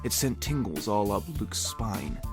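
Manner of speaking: normal talking voice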